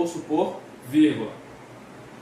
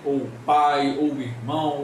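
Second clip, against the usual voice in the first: usual speaking voice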